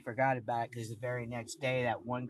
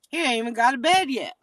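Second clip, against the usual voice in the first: higher, louder and fast, fed up; real indignation, raised and quick; indignant outburst; 9 semitones higher, 10 dB louder, faster than usual. Real, not acted. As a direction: spit it out in disbelief. Outrage over others moving in.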